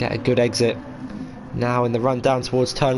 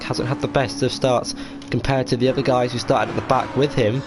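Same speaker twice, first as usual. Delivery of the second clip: live race commentary, rising with action; motorsport commentator; 2 semitones higher than usual. Real, not acted. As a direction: call the racing as it unfolds.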